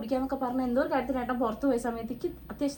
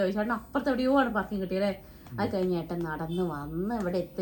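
usual speaking voice

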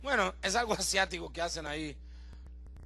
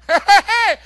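bright mock giggle, louder; mock happy laugh; 10 semitones higher, 17 dB louder, slower than usual. Shown as a demonstration. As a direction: laugh like all is going well. Imita alegría cuando va bien. (risa fingida de alegría)

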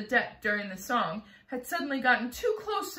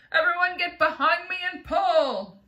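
loud, high rallying command; shouted; 7 semitones higher, 7 dB louder than usual. A narrator's reading. Grasshopper rallies the others to haul, shouting. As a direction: shout orders to a hauling team. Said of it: "shouted the gr-, old green grasshopper".

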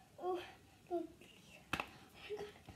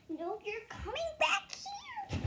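exasperated order; 9 semitones higher, slower than usual. Acted. Ordering runaway sibling back.

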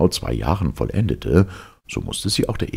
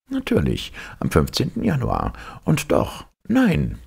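high-pitched professor calling out; professor exclaiming; 12 semitones higher than usual. A narrator's reading. Professor correcting himself before the captain, high exclamation. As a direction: cry it in a high character voice. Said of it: "rief der Professor".

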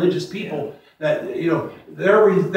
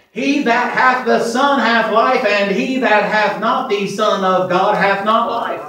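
slow, loud, higher scripture proclamation; preached scripture; 5 semitones higher, 3 dB louder, slower than usual. Acted. Preacher to congregation, a slow loud scripture proclamation. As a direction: proclaim the verse slowly with force.

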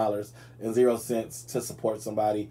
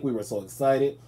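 normal talking voice

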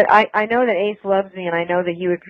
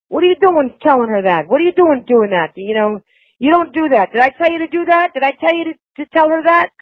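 higher, rising mock fury; retold angry phone rant; 8 semitones higher than usual. Husband chewing out his friend on the phone, imitated fury. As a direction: scold him like a furious boss, climbing higher. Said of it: "flipped out".

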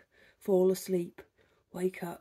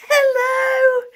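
high, loud, cheery hello to a stranger; retelling an embarrassing greeting; retold greeting shout; 12 dB louder than usual. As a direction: wave and call out to someone you think you know. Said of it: "And went".

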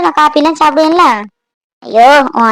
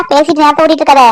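ordinary delivery

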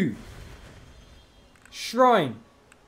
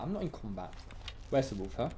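ordinary talk, nothing marked

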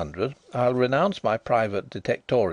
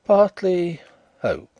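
higher, louder, a touch of tragedy on partly hope; nearly tragic; 9 semitones higher, 7 dB louder, slower than usual. Acted. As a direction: let the hope ache a little. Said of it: "something more nearly tragic in his voice".

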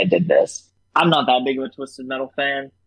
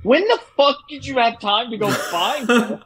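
high-pitched disbelieving question; incredulous outburst; 11 semitones higher than usual. Retold or imitated. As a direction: when did he even find her, pitched way up. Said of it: "I'm like".

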